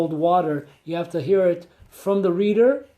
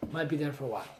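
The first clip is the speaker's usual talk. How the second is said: the words "Might be there for a while" muttered much softer and lower as an echo; quiet aside; 6 semitones lower, 15 dB quieter, much faster than usual. Shown as a demonstration. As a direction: drop the voice right down, like a rueful echo to yourself. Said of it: "repeated quieter aside".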